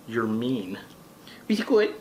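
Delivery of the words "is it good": doggy voice. Acted. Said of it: "That's my doggy voice".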